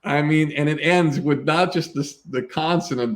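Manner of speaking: ordinary talk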